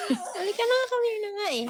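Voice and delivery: high, pleading call; whiny pet call. Real, not acted. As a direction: beg the dog to come. (nagmamakaawang tawag)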